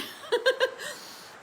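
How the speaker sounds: high, airy giggling laugh; amused laugh. Real, not acted. Laughs at own covid moving story.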